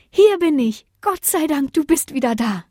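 relieved wife. A narrator's reading. Lena greets her returning husband, relieved. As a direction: answer high, then ease down in relief. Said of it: "sagte sie erleichtert".

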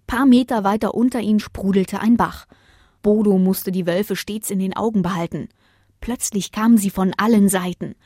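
ordinary delivery

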